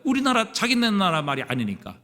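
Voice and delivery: ordinary talk, nothing marked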